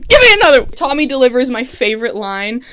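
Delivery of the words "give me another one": much higher and louder, eager; hyped demand. Real, not acted. Vlogger begging for more twists, loud and high. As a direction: crank it up on the repeat. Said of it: "repeated, escalated".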